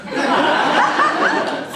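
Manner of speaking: loud squealing laugh; high loud laugh. Real, not acted. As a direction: burst into a high cackle. Big laugh after bit.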